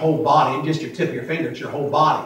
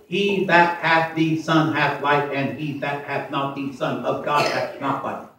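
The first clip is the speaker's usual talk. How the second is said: slow, quieter second reading; scripture read softly; 3 dB quieter, slower than usual. Acted. Preacher repeating the verse, slow and quieter. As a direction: read it again calmly, word by word.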